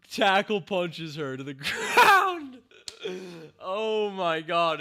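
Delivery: high, loud and slow through laughter; giddy laughing shout; laughing delight. Real, not acted. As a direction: crack up while getting the words out. Laughing hard at the scene.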